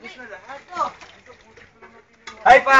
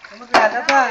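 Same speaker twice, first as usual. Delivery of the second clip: usual speaking voice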